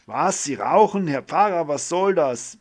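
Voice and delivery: high, loud, indignant scolding; outraged. Acted. Doctor scolds the priest for smoking, loud and outraged. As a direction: catch him in the act and tell him off.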